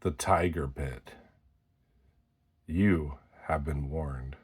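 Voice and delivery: low, hushed warning; ominous hush. Pretend. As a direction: drop the voice like a dark warning. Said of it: "You are entering".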